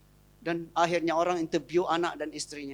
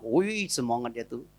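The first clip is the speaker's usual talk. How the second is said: ordinary talk, nothing marked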